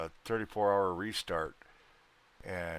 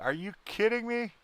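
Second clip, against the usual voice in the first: stunned disbelief; 13 semitones higher than usual. Retold or imitated. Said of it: "I was like".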